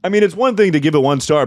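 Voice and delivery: loud, high indignant rant; outraged. Real, not acted. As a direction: vent indignation at the critic. Outraged at the review.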